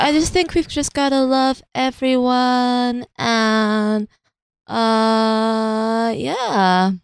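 high, slow, drawn-out voice; dreamy pop star. Acted. Acting [name] at imagined party.

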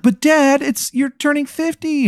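put-on high whiny kid voice; kids whining at dad. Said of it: "and they're like".

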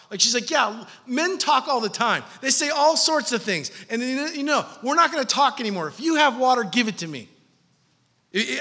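loud, high, driving sermon voice; full-force preaching. Acted. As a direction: preach it hard and climbing.

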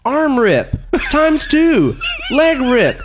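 high, rising excitement; excited listing. Real, not acted. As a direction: read the gore notes with glee. Excited list of gore.

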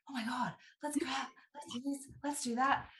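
high, breathless whispered rush; excited whisper. Retold or imitated. Telling her husband what her hormones want, in an excited whisper. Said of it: "my hormones are like".

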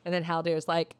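normal talking voice

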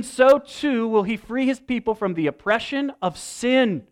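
loud, high, ringing proclamation; soaring pulpit climax; full-force preaching. Acted. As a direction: preach it out to the whole room. Preaching climax on God's victory.

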